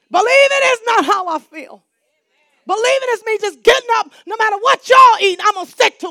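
preacher urging the church to believe; fervent preaching. Real, not acted. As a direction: press it home with force.

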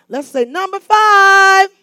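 high, slow, drawn-out loud call; stretched preacher's holler; rallying shout. Real, not acted. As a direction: call it out to the whole congregation. Rallying congregation to respond.

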